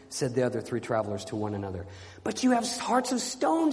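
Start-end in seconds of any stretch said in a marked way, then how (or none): 2.2-3.7 high, impassioned reproach; anguished reproach. Acted. Knight to unmoved travellers, high anguished reproach. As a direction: cry it out in a high pleading voice.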